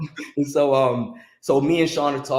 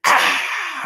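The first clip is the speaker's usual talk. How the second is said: high mock crowd scream; mimicking a screaming crowd; 5 dB louder than usual. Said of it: "the crowd just screams".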